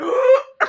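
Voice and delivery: sharp, high gasp of disbelief; loud shocked gasp. Retold or imitated. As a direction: gasp like you just got a notification from him. Seeing he followed their Instagram.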